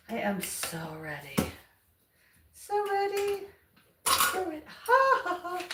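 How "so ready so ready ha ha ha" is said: high giddy squeal. Real, not acted. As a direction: squeal with glee. Giddy before painting.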